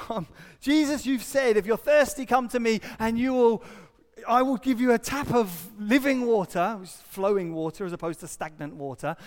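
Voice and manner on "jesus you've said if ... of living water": raised, loud, higher voice; loud, urgent plea quoting scripture; impassioned preaching. Acted. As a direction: proclaim the promise with force. Impassioned quoting of Jesus' promise.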